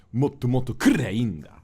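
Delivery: loud, high mock rude Japanese line; imitating Shu's brash dialect on "Motto, motto, kurei inda.". Imitating his friend's rude line, loud and high. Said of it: "the way he pronounced it".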